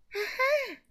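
high elf aha with a smile; pleased with himself; very high fairy aha; elf chuckle. A narrator's reading. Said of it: "小精灵笑了笑".

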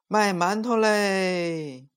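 slow, drawn-out hawker cry; street vendor's call. A narrator's reading. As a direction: call out buns for sale. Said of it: "一边走一边叫唤".

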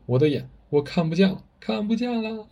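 a blinded man groping in terror; blinded, panicking. A narrator's reading. Chief gropes at his ruined eyes, rising panicked wail. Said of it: "他两手颤颤巍巍地摸着眼".

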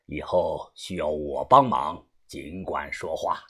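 breathy chuckle into low thanks; deep, unhurried boss promising payment; old gang boss, pleased. Acted. (把头低沉而满意地道谢)

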